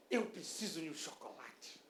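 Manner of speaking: low, soft mock-miserable plea; mock pitiful craving. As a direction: whimper it quietly, playing for pity. Mock craving, acted excuse. (lamento fingido, baixinho e grave)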